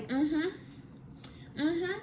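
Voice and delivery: soft, suspicious mm-hmm; skeptical mm-hmm. Retold or imitated. Imitating the boyfriend scrolling her phone, softly doubtful. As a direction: hum it like you're unconvinced. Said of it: "just kept saying".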